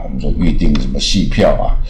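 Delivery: ordinary talk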